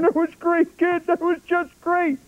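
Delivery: high-pitched imitation of a moved old director; tearful director's praise. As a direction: gush praise like a director close to tears. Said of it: "he's crying, and he's hugging me".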